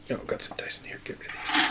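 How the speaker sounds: breathy whisper to self; whispered aside. Real, not acted. Player talking to himself while clearing dice, whispered. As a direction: whisper it under your breath.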